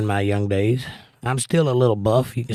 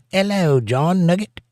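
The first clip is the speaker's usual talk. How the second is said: lightly mimicked accent with a dropped H, pitched up; put-on accent; 6 semitones higher, slower than usual. Host reading a viewer's greeting to John, put-on accent. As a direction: read the greeting in a playful, higher put-on accent. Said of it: "she said it with an accent".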